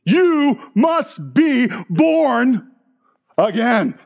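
very slow, word by word; emphatic declaration. Real, not acted. Preacher answers a generation about birth, slow emphatic proclamation.

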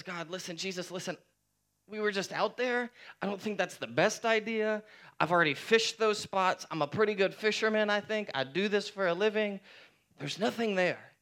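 high pitched, pushing-back fisherman; reluctant Simon. Retold or imitated. Simon Peter protesting to Jesus, high and defensive. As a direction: argue your expertise, a bit defensive.

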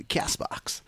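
breathy whispered aside. Acted. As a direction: whisper the last name like a secret. Podcast app list punchline.

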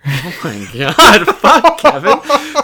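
loud, helpless laughing protest; cracking up. Real, not acted. Host losing it at co-host's crude joke, cracking up. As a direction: blurt it out through laughter.

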